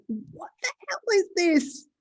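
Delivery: mock disbelief at going viral; stunned.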